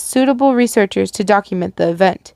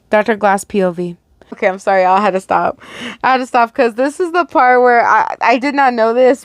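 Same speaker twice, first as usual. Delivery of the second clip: ordinary delivery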